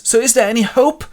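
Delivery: high, loud, urgent asking; anxious question. Acted. John rushes in and asks the nurse, anxious high question.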